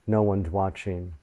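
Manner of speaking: ordinary delivery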